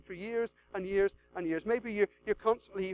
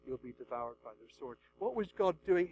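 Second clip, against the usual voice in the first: ordinary talk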